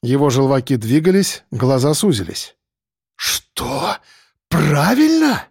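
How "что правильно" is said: hushed first word, then high incredulous question; strained, disbelieving rage; through gritted teeth. A narrator's reading. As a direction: seethe in disbelief, pitch leaping up. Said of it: "процедил сквозь зубы Андрей".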